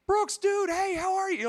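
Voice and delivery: excited greeting. Retold or imitated. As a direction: call out his name with joyful surprise. Excited greeting of old friend.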